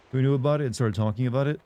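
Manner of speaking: normal talking voice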